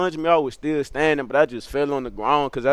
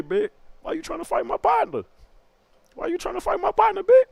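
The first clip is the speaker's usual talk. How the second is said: much higher, smooth put-on street voice; Baby Josh's smooth voice. Baby Josh taunting the narrator before hitting him, smooth high voice. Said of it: "he accent on some smooth shit".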